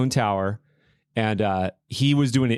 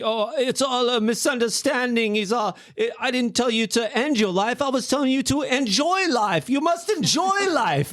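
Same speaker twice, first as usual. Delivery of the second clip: put-on much higher shaky British accent, slowing at the end; wobbly British rocker voice, almost falling over; high shaky British accent, laughing through the last line; 12 semitones higher than usual. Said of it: "in his shaky British accent".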